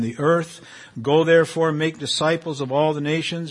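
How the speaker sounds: measured, solemn Bible reading; scripture reading. Acted. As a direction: read it out like scripture before a congregation. Reads Matthew passage aloud.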